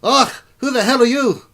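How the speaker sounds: loud, high dwarf king challenge; irritable dwarf ruler caught mid-argument; gruff-tempered dwarf king. Acted. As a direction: demand it of intruders at full volume. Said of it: "and then Brunhauer stops".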